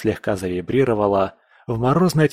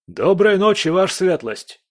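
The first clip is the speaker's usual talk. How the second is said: calling out; 9 semitones higher than usual. A narrator's reading. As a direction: call up to the roof. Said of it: "крикнул один из них".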